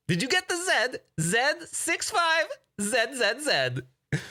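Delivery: high, slow mock German reading out a code; hammed-up German accent relishing every "zed"; terrible German accent. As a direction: spell it out like a squeaky German caller. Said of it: "I do a terrible German accent".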